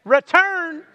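high drawn-out Return; said together. Shown as a demonstration. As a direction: lead the room on Return, high then falling. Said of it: "Let's say that together".

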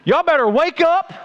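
high-pitched mock street prophet; calling out a warning. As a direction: proclaim doom to a whole city. Jonah calling out warning to Nineveh.

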